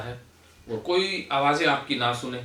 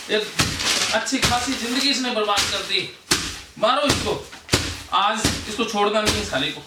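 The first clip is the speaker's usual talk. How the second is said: higher, driving coach's urging, chanting commands; fired-up exhortation; 8 semitones higher, 3 dB louder than usual. Acted. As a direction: whip the group into venting their rage. Catharsis guide urging anger release. (जोश भरा, ऊँचा, उकसाता हुआ आह्वान)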